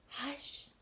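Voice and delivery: high, airy whispered shush; whispered hush. A narrator's reading. Animals hush the mouse, whispered. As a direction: shush them softly and urgently.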